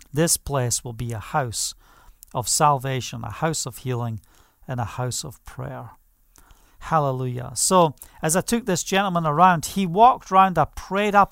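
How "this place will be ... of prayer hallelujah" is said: quiet blessing. Shown as a demonstration. Quiet declaration over property.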